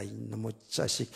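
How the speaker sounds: low, slow, muted swear; toned-down cursing. Retold or imitated. Preacher retelling his anger at a driver, deliberately softened curse. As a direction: restrain the insult, keeping it very mild and quiet. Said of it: "이건 지금 굉장히 순하게 얘기하는 거예요".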